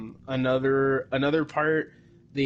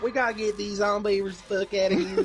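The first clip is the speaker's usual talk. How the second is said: bad Southern accent; 8 semitones higher than usual. Retelling Zoe's line from the movie, bad Southern accent. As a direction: imitate a fake Southern accent, pitched much higher. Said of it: "only Zoe has a Southern accent".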